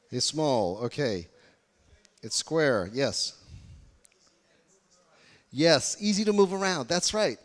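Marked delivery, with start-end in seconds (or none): 5.5-7.4 excited. Real, not acted. Praising audience answer.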